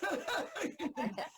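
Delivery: slow words through a laugh; amused, slowed, laughing aside. Real, not acted. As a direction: talk while still laughing. Laughing while talking.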